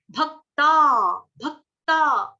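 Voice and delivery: भक्ता slow, क joined into त; slow teaching demo of भक्ता; drawn-out bhakta. Shown as a demonstration. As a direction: stretch भक्ता with the half क. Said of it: "आधा क पूरा त".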